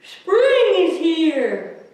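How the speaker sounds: high, loud and slow; Mole announcing spring. A narrator's reading. Mole tells Bear spring has come, slow and high. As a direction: stretch it out proudly, high and loud. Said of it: "mumbled Mole".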